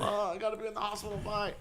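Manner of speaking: high, quick, strained cartoon voice; mock wounded man. Injured cartoon man excusing himself from a date, mock pained voice. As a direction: softly squeeze it out as if hurt.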